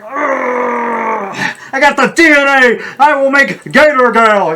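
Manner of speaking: high, slow villain gloat; mad scientist voice. Retold or imitated. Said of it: "You're like".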